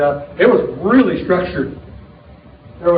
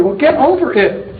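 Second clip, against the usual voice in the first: higher and slow, teasing the timid; blunt retort; 6 semitones higher, slower than usual. Retold or imitated. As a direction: toss it back with a grin. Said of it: "I really am uncomfortable".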